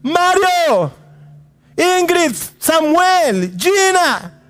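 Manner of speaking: high ringing call of names from the sky; angel calling from heaven. Acted. Angel shouting names from heaven to the listeners. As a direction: call each name out like a voice from heaven. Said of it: "el ángel del Señor gritó".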